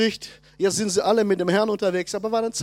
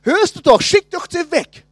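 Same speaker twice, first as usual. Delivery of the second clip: annoyed disciples; 6 semitones higher, 5 dB louder than usual. Retold or imitated. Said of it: "Die Jünger sagen".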